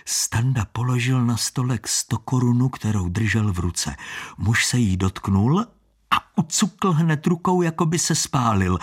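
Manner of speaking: ordinary delivery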